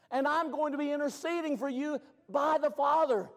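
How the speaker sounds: loud, high preaching cry; preaching at full force. Acted. Preacher crying out to the congregation, full force. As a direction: preach it out to the church.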